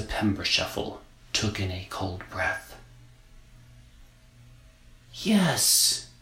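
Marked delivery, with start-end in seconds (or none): 5.1-6.1 put-on higher, exhaled girl yes; breathy little girl. A narrator's reading. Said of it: "she said on the exhale".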